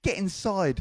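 high-pitched grandma calling out. Retold or imitated. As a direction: summon the child in a high grandma voice. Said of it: "That's how she sounded".

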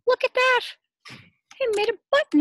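high, loud delighted cry; excited exclaim. Acted. Teacher revealing the button to the class.

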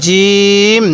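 firm "j", then a long level "eem" in জিম; hard জিম. Shown as a demonstration. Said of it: "জিমটা আমরা শক্ত করে উচ্চারণ করব".

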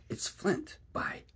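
breathless, high, terrified whisper. A narrator's reading. Pirate recognises the dead captain's voice, terrified whisper. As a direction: gasp the name out in a whisper. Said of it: "cried Mary".

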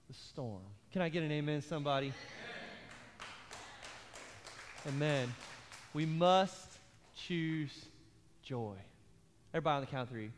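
preaching. Acted. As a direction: land the sermon's point gently. Preaching, call and response.

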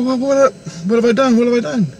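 high-pitched mock panic; retold distress. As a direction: act out an alarmed "what have I done". Retold wailing of wife.

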